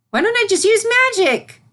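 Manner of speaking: very high, loud little filly; excited idea. Acted. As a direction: burst out with a bright new plan. Filly's idea delights her.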